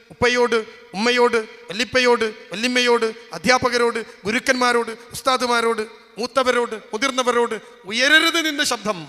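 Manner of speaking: loud list, each item hammered out; booming preacher's exhortation; forceful admonition. Acted. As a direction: voice a father's stern warning at full volume. Preacher acts father's stern admonition.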